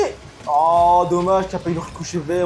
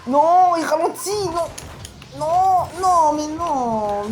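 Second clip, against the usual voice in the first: cri de dépit; 10 semitones higher, much slower than usual. Real, not acted. Ballon qui ralentit.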